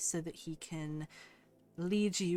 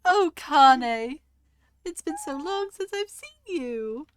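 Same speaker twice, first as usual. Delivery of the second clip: delighted greeting; 10 semitones higher, 8 dB louder, slower than usual. Acted. Queen greets long-lost brother.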